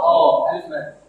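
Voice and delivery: اه stretched as a long open aa; 1 long a sound. Shown as a demonstration. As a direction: draw out اه as a long vowel. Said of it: "وبيتنطق". (نطق اه ممدود)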